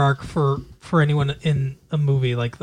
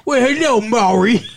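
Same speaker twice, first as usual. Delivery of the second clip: hammed-up, higher-pitched fake British accent; 6 semitones higher, 5 dB louder than usual. Showing the co-host how bad it was, mock British accent. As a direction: put on a loud, terrible British accent.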